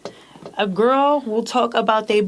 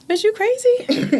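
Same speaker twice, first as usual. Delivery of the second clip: mock shock; 11 semitones higher, slower than usual. Retold shocked retort.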